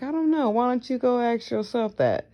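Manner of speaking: high, sharp comeback, imitating herself; sassy high retort. Brushing off a nosy person with a high sassy retort. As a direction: toss the question back with attitude. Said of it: "I'm like".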